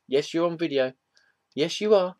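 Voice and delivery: high, indulgent sing-song; pet talk. Real, not acted. Talks to the girls off camera.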